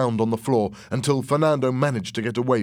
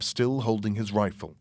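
usual speaking voice

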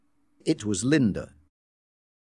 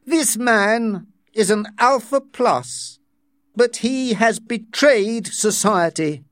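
slow, raised pitch accusation; stern director voice; 10 semitones higher, slower than usual. Acted. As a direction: denounce him before the room. Director denounces Bernard.

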